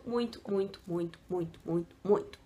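soft, low string of repeats; softly trailing repetition. Real, not acted. Repeats word for emphasis, trailing off.

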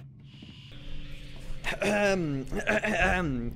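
long, repeated throat-clear; drawn-out "ahem". Real, not acted. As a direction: clear your throat pointedly before an announcement. Clears throat before announcing.

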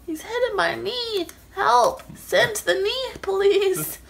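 high, loud mock cry for help; playful yelling. Real, not acted. Calling for help, laughing.

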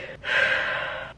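heavy unvoiced sigh; shaky-moment sigh. Real, not acted. Upset vlogger telling viewers about the layoff, sighing. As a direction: let out a long sigh. Upset, pausing over being laid off.

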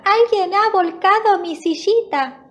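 high-pitched little bear cub; tiny thin voice. A narrator's reading. Little bear finds his chair tipped over, thin high voice. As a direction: squeak it out like a small storybook bear. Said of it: "grita con voz finita".

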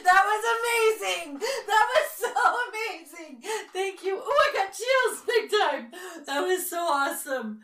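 high, loud, giddy and laughing; thrilled squeal. Real, not acted. Thrilled by the ghost app's answer, squealing. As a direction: gush with chills and disbelief.

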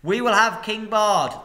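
loud, high defiant shout; crowd shouting back. Acted. The people near at hand shout back at the master, loud. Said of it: "shouted in reply".

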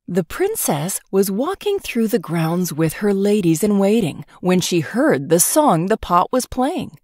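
ordinary delivery

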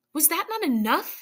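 high-pitched angry challenge; angry demand. A narrator's reading. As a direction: demand it with some anger, pitch lifted. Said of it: "he demanded angrily".

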